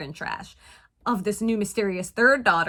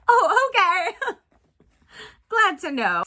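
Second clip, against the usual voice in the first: high laughing "oh okay"; 9 semitones higher, 5 dB louder than usual. Real, not acted. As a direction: laugh it off in disbelief. Laughing reaction.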